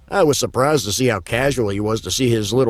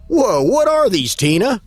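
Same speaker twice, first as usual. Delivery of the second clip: surprised; 10 semitones higher, 3 dB louder than usual. Acted. Shocked at stuffed animals.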